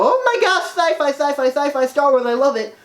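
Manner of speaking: high, squealing fangirl voice; hammed-up geeky squeal; giddy sci-fi nerd. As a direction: play an overexcited nerd gushing. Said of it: "sci-fi nerd and go".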